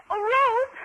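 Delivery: high, flustered plea for help; panicked plea. Acted. Mildred begs Ruth to help pick up the dropped tray, high flustered plea. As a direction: beg for help in a rush.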